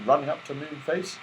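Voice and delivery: usual speaking voice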